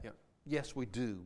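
ordinary delivery

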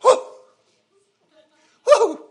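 sudden high, loud whoops of joy; imitating a churchgoer's loud joyful whoop; joyful church shout. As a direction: whoop it out like a sister getting happy in church. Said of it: "all of a sudden I hear her go".

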